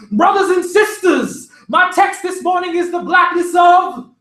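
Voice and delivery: loud, high preaching shout; Sunday sermon shout, full force; preacher's shout. A narrator's reading. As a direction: thunder it from the pulpit. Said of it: "I heard someone shout".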